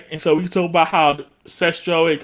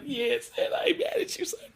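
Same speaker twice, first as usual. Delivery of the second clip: soft high cheer; 13 semitones higher, 8 dB quieter than usual. Retold or imitated. Cheering for Zed.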